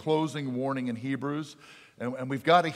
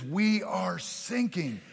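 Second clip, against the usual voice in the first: higher, drawn-out mock distress; slow emphatic repeat; 6 semitones higher, much slower than usual. Sinking caller repeating for the radio officer, slow and high. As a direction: spell it out slowly, pitched up.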